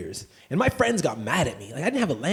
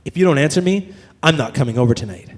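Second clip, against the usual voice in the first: pissy texter; 3 semitones lower, 6 dB louder than usual. Acted. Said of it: "the next one".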